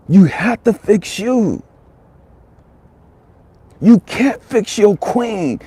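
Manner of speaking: high, loud, slow preacher's cry; preaching at full force. Acted. Preacher calling the family to fix themselves, full preaching cry. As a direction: drive the refrain home from the pulpit.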